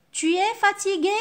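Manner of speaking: rising question tune, pitched high; question intonation. Shown as a demonstration. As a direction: ask it with a high questioning lift. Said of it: "J'ai changé seulement l'intonation".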